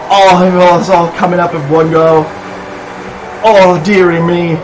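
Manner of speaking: ordinary delivery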